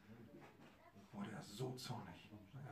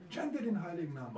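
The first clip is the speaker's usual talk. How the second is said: loud call; 7 semitones higher, 8 dB louder than usual. Acted. Preacher calls the drunkards again.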